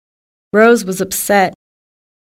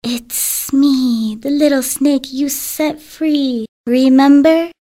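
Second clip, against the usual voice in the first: lightly voiced shy little snake, slow; gently shy, drawing the words out; 4 semitones higher, slower than usual. Little snake shyly reminding Rose it was freed, lightly voiced. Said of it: "a low, shy voice answered".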